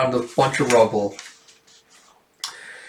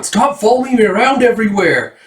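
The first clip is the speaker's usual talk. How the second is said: high-pitched exasperated character yell; acted, high cry of protest; 8 semitones higher, 4 dB louder than usual. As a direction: call it out high, as if fed up with being followed.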